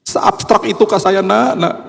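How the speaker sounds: high, slow, plaintive appeal; mock-wounded question. Father asking his child about the portrait, mock-wounded high plea.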